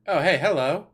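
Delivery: loud, bright hello; excited greeting. Real, not acted. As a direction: greet with delight. Second avatar appears.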